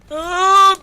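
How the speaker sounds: startled yelp. Real, not acted. Sudden hit in game.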